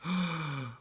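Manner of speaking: sharp startled gasp; fearful intake of breath; gasp of fright. Acted. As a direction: catch breath at the shovel. Shovel breaks through.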